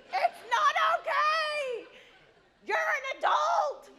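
slow, high, loud hammed-up crying protest; loud mock sob of outrage; mock wailing. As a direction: wail it like a melodramatic mother.

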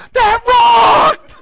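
explosive high, loud imitation; mock-ecstatic yell at full volume; thrilled kid's shout. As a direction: burst out like an overjoyed kid. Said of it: "He takes a breath. and goes".

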